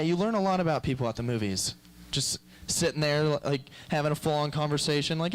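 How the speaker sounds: ordinary talk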